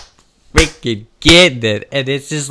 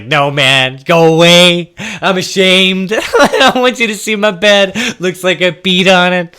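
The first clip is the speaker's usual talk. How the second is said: flustered refusal; 7 semitones higher, 6 dB louder, slower than usual. Retold or imitated. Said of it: "And I was like".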